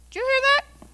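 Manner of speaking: high, loud startled question; astonished. Acted. Shopper who hears a teacup talk, astonished. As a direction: pitch it right up in mock amazement.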